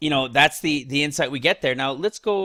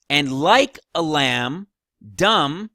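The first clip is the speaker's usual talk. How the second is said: slow and louder, each key word set apart; emphatic scripture reading; 5 dB louder, much slower than usual. Acted. Preacher quoting the lamb verse to listeners, slow and emphatic. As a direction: hit the verse hard, pausing before the last word.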